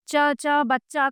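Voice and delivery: "च, च, बच्चा" spelled out slowly, the doubled च held apart; teacher sounding out "बच्चा" letter by letter; slow letter demo. Shown as a demonstration. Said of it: "दो समान व्यंजनों के संयोग से". ("बच्चा" का धीमा उच्चारण, "च, च" अलग-अलग बोलकर)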